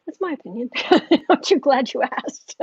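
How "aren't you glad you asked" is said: laughing. Real, not acted. Laughing after rant.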